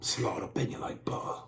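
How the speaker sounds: unvoiced whisper in Indian accent; breathy hushed Indian accent; whispered mock accent. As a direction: whisper it with a thick Indian lilt. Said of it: "Indian accent".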